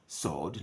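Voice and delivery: "sword" said as sord, no w sound; silent w in sword. Shown as a demonstration. Teacher showing the class the silent w. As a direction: say "sword" without the w. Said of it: "not sword".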